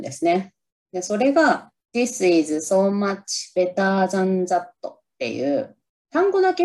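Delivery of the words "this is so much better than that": spelled out slowly, word by word; slow English line. Shown as a demonstration. Vlogger shows the movie line to viewers, slow and careful. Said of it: "このセリフめちゃくちゃ練習しました".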